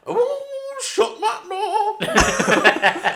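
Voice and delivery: very high, very slow camp catchphrase; quiz host impression. [name] impersonating a camp quiz host, high and slow. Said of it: "doing impressions of his quiz show host".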